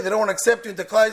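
ordinary talk, nothing marked

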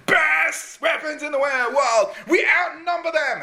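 hype shout. Retold or imitated. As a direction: whip the troops into frenzy. General hyping troops.

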